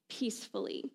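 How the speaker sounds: normal talking voice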